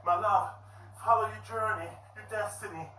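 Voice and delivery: high, loud hammed-up farewell; melodramatic dying father. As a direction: proclaim your last words, stretching the end. Exhausted father's mock dying speech.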